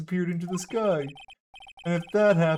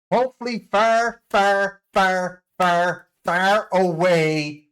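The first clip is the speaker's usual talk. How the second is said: far stretched loud, slow; 2 semitones higher, 5 dB louder, slower than usual. Acted. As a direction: drag each far out, loud. Drawn-out repeated far.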